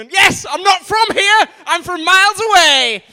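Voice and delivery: loud, high and slow, hammed-up as the audience member; mock overexcited fan. Comic voicing the keen guest who is not from here, loud and slow. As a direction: bellow it like a fan thrilled to be from far away.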